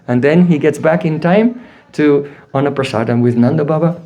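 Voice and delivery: ordinary talk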